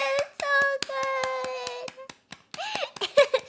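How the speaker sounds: high, squealed through laughter; delighted, breaking up with laughter; helpless laughing. Real, not acted. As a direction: crack up while gushing. Cracking up over the scene.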